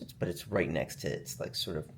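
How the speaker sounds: usual speaking voice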